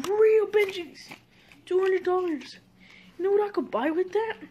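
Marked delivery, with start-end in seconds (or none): 0.1-2.6 excited. Real, not acted. Excited over money in mail.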